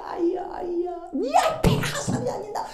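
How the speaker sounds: high, urgent cry across the field; calling out. Acted. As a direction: call out the signal, urging him to run. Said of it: "소리 지릅니다".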